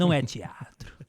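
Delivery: put-on thick carioca accent; heavy Rio de Janeiro accent. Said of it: "com sotaque carioca carregadíssimo".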